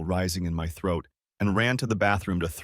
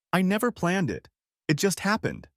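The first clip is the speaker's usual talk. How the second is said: crying; 10 semitones higher than usual. A narrator's reading. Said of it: "she cried even harder".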